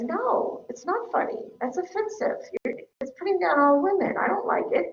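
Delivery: high, soft, prim objection; mock-offended, quietly firm protest; acted disapproval. Acts objection to offensive meme.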